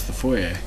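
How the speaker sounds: "foyer" corrected to end in "ay", said softly; "foyer" ending French-style, no final r; French pronunciation. Shown as a demonstration. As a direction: quietly correct "foyer" to FOY-ay. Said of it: "we learned it's French".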